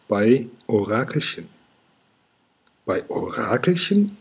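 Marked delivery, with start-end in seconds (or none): 2.9-4.2 high, astonished question rising sharply at the end; surprised call. A narrator's reading. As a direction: call it out in disbelief. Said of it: "rufen die Drachen".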